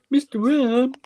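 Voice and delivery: high, rising little voice; toy voice. Shown as a demonstration. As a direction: say the name like the toy would. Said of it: "You can go".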